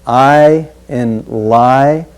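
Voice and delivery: slow "I in li" with a long drawn diphthong; stretched diphthong. Shown as a demonstration. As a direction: draw out the I in "li" slowly. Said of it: "Notice the diphthong".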